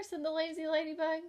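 normal talking voice

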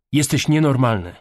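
outraged retort; angry counterattack. A narrator's reading. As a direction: hit back, appalled. Daughter counter-attacks, stunned.

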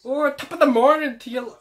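high, loud mock Irish greeting; bad Irish accent. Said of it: "Can't really do an Irish accent".

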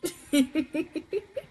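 a high, rising laugh. Real, not acted. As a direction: laugh at the screen. Reacting to the show.

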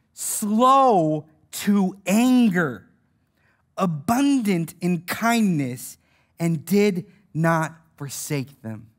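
very slow, phrase-by-phrase scripture reading; emphatic solemn reading. Real, not acted. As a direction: draw out every phrase so it sinks in, lowering towards the end. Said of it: "need to hear that again and remember".

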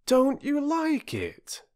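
high, slow, anxious question; boy asking a crying woman again; worried boy. Acted. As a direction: ask it gently again.

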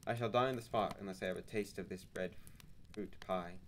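ordinary delivery

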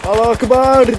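loud hammed-up battle cry; mock war cry. Gamer yelling a joke war cry while charging.

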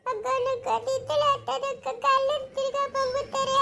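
put-on a frightened child clinging to her sister. Younger sister afraid the thugs come back, in a small scared voice.

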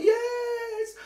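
loud, very high drawn-out mock wail. [name] confirming her sister will sue, in a mock wail. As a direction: imitate a distraught friend wailing.

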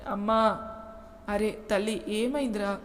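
mildly distressed mother's questions; understated motherly distress; pained concern. A narrator's reading. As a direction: ask with a touch of worry, pausing between calls. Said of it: "సౌజన్య బాధగా".

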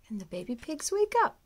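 ordinary delivery, nothing marked

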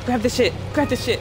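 panicked repeated yell; rushed high panic; scared horror game streamer. Real, not acted. Panic while fleeing enemy.

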